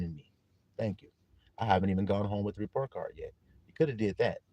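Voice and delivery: low, soft, earnest thanks; sincere gratitude. Acted. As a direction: thank Mom quietly, as if thinking to yourself. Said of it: "sitting in class like this".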